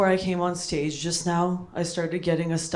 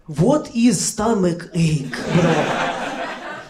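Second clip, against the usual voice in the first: put-on heavy Russian accent, slow and louder; baffled immigrant dad with a thick Russian accent; Soviet father; 3 dB louder, much slower than usual. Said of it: "your Soviet father".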